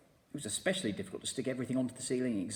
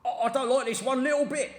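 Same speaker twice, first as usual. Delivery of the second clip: higher, loud, alarmed; frightened and confused; 13 semitones higher, 9 dB louder than usual. A narrator's reading. Mister Twit panicking about being upside down.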